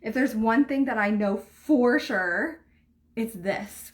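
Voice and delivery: ordinary talk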